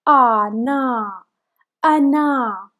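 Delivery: ana with a drawn-out long a. Shown as a demonstration. Teacher modelling the long a in ana. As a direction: stretch the first a of ana. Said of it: "It is a long sound of a".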